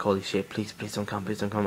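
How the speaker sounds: quiet, scared begging under his breath; nervous pleading. Retold or imitated. Scared kid at home, softly begging the dad not to come. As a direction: pray quietly that nobody comes. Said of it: "I was, like, pretty nervous".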